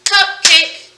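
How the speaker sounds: loud two-beat cupcake; clapped syllables. Shown as a demonstration. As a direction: clap out cupcake in two beats. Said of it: "So let's clap it".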